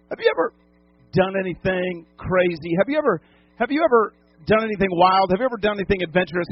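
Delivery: ordinary talk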